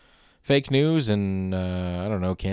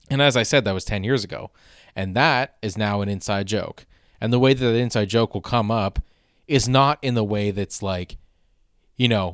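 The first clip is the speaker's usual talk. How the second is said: ordinary talk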